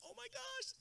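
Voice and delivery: high, breathless mock gush; impression of wife. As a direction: gasp it out like a starstruck bride. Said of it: "That's my impression of my wife".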